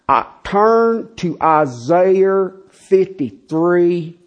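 very slow, thick Southern drawl, hardly any inflection; monotone Southern drawl. Retold or imitated. Pastor mimicking professor announcing a Bible passage, slow Southern monotone. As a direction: drone it out, stretching each Southern vowel. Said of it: "that was what it was like".

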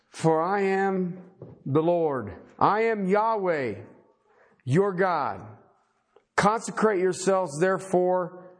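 solemn, measured reading with drawn-out phrases; scripture reading. Acted. Preacher reading God's command to the congregation, solemn scripture reading. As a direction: read out God's words in spaced, weighty phrases.

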